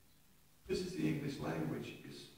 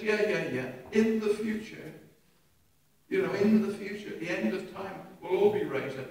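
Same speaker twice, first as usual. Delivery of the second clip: mock religious; 5 semitones higher, 6 dB louder than usual. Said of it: "she went all religious, theological".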